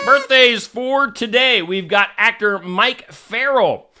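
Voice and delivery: loud, slow announcer call; announcer shout. Acted. Host announces celebrity birthdays, loud slow announcer. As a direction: boom it like a ring announcer.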